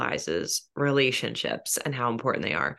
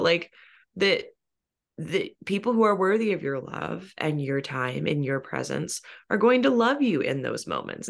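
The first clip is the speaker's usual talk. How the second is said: ordinary talk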